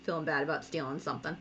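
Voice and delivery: soft, low mock pity; play-acted little pang of guilt; sad, guilty. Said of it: "little sad ears".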